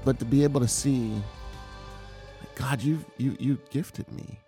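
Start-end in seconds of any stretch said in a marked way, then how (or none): none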